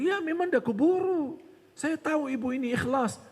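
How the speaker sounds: high, eagerly agreeing tempter; syaitan membujuk. Acted. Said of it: "terima kasih syaitan". (setan mengiyakan dengan nada tinggi)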